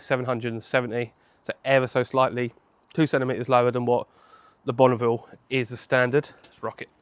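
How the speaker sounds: usual speaking voice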